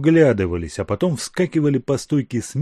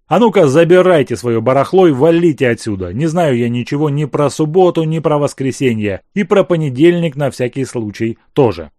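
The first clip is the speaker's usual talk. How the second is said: loud, rough dismissal; angry; 3 semitones higher, 7 dB louder than usual. Acted. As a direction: snap at him to get out. Azef throws the guest out.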